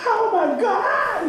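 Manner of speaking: high, slow mock scream of panic; hammed-up screaming swimmer. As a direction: stretch out a panicked cry, pitched high. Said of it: "some guy screaming".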